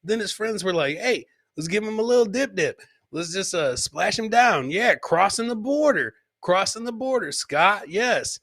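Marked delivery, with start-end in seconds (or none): none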